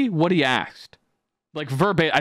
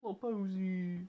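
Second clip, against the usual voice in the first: very soft, slow, high coo; cooing at a pet; 5 semitones higher, 16 dB quieter, slower than usual. Real, not acted. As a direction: melt over your little dog. Cooing over beloved dog.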